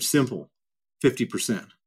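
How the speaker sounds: a hint of a cold dictator naming his cut; terse Putin reply. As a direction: drop the voice, say it as a flat, final price. Retelling Putin's curt answer.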